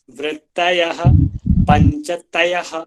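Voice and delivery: पंचतयः slow, with a light half-voiced य; teacher demonstrating the soft half य in पंचतयः; soft half sound. Shown as a demonstration. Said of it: "हल्का sound देते हुए".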